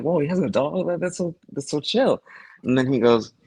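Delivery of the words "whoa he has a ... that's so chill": higher, excited retold reaction; mock delighted surprise. Host retelling own reaction to villain's dog, delighted. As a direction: gush over the cute dog.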